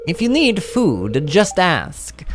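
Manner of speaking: high, slow Italian accent attempt. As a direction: play a kindly Italian, unconvincingly. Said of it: "I wanna do an Italian voice for Gus".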